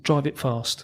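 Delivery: lightly posh upper-class voice; a hint of a posh gent, quietly. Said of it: "Something like that in his posh voice".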